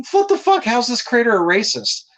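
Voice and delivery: high-pitched incredulous outburst; indignant disbelief. Retold or imitated. Creator defends accused friends, indignant and incredulous. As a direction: shoot up in pitch, baffled by the accusation.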